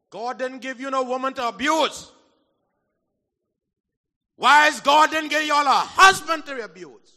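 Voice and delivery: booming sermon climax; full-force preaching. Acted. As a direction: preach it out to the church, building to a cry.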